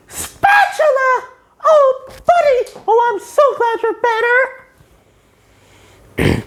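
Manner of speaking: squeaky put-on SpongeBob. Reviewer quoting SpongeBob, high cartoon voice.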